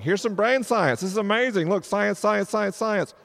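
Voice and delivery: high, loud burst of mock excitement; excited hype. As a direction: pitch it high and fast-firing, like dangling a shiny distraction. Hyping science to distract the skeptic.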